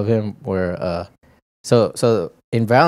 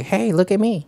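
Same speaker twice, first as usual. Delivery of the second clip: put-on high, attention-seeking child voice; little kid; 10 semitones higher than usual. Little kid wanting everyone watching, high and showy. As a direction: pipe up like an eager kid. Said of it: "that little kid who was like".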